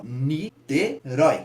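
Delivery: "Niterói" very slowly split, ending on a stressed RÓI; ni, te, RÓI, with gaps between; stressed syllable demo. Shown as a demonstration. Teacher checking the tonic syllable aloud, slow split with stressed RÓI. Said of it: "uma ascendência no rói".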